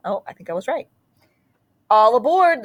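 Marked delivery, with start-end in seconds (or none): 1.9-2.6 conductor's call. A narrator's reading. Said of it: "the conductor calls".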